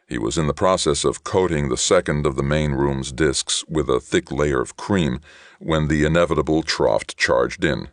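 usual speaking voice

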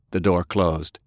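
ordinary delivery, nothing marked

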